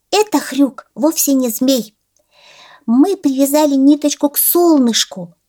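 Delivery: high squeaky piglet voice. Acted. Piglet told about the sun.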